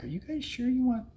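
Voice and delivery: high, mock disbelieving question; doubtful question. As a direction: retell the old doubt in a lifted, incredulous voice. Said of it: "at first I was like".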